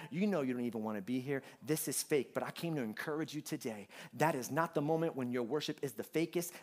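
ordinary delivery

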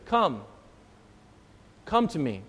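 quiet, gentle call; soft invitation. Acted. Preacher voicing Jesus calling each of us, soft. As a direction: invite them in, softly.

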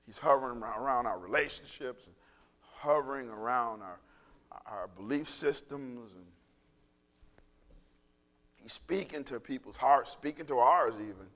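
low, earnest pastoral prayer voice. Acted. Pastor praying for the congregation, earnest and low. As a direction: pray over the congregation, unhurried.

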